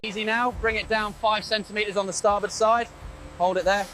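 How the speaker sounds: crew chatter over headset radio; helmet radio. Real, not acted. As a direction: sound like a radio transmission.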